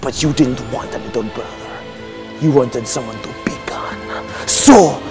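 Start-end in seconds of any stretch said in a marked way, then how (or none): 0.0-4.0 put-on low, hushed, accented self-pity; wounded. Villain recalls being picked on, soft wounded accented lament. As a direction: drop to a small hurt voice, keeping the put-on accent.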